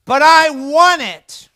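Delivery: loud, high, stretched-out tantrum yell; screaming child. Acted. Daughter raising her voice at her father, screaming. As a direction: scream it out like a little girl. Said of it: "and she screams out".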